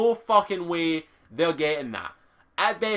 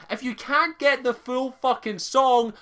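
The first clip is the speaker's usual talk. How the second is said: normal talking voice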